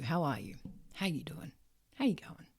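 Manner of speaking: soft whisper, barely voiced; whispered question. Asking someone genuinely how they are, in a whisper. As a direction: breathe the question out gently.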